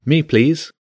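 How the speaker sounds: put-on eager, high-pitched child volunteer; enthusiastic. A narrator's reading. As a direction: pipe up keenly, like a pupil. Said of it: "She needn't have been so enthusiastic".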